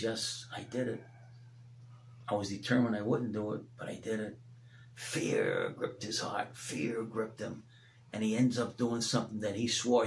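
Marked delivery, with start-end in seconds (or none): 0.0-4.4 quiet, heavy admission; soft confession. Retold or imitated. Peter's confession voiced. 5.0-7.6 slow, much lower and softer; low dramatic hush. Acted.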